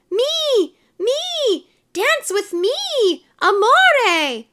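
very high squealing admirers; clamor to be picked, stretching each word; shrill lovestruck chase; crying out. A narrator's reading. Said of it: "cried the ladies".

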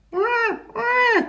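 very high, drawn-out wail; squeaky animal wah; baby seal cry. A narrator's reading. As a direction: bleat like a lost baby seal. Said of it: "cried the baby seal".

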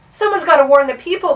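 loud high urgent cry. A narrator's reading. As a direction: raise the alarm. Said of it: "cried Bartholomew".

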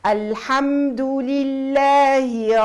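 tajweed model reading; slow recitation. Shown as a demonstration. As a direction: recite calmly and stretched. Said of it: "teacher demonstrating recitation".

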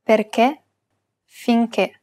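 stressed closed E at the end of "Perché, finché". Shown as a demonstration. Teacher showing acute accent, closed final E in "Perché, finché". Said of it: "pronounce it as a closed E".

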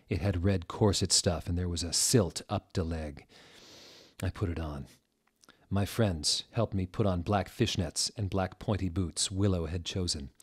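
ordinary delivery, nothing marked

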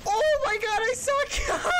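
high-pitched wail of self-blame; real dismayed outburst. Real, not acted. Player cursing their own bad play, dismayed cry.

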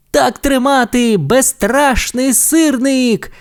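very high, slow cheering chant; crowd cheer. Acted. Crowd hails the tow truck hero, cheering. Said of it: "кричала юрба".

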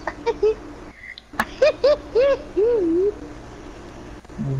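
high laugh. Real, not acted. Laughing at friend.

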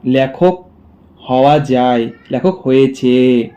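normal talking voice

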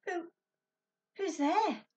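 high scared squeak; squeaking in fright. Acted. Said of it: "Ben squeaked".

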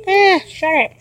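loud fed-up snap; exasperated yell. Real, not acted. Owner snapping at the noisy cat, loud. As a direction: tell the cat off loudly.

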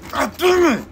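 high, loud angry shout; real furious yell. Real, not acted. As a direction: blow up at dying. Just got killed in game.

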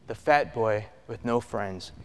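low, soft contemptuous father's line; hushed scorn from a father passing by; cold father. Retold or imitated. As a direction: mutter it down at the boy, dismissive. Father's cold greeting retold.

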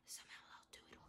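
conspiratorial whisper. Acted. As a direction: hush it like a secret promise. Dad secretly plotting McDonald's.

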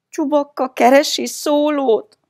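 very high, loud, weepy voice; tearful whine. A narrator's reading. Child radioing for help among scornful friends, whiny and tearful. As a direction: wail it as if near tears. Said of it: "sírós hangon".

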